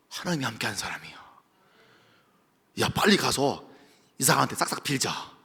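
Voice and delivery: lightly voiced frightened herdsmen, low and hushed; Philistines. Herdsmen scared of Isaac, hushed and low. Philistines' fearful talk acted.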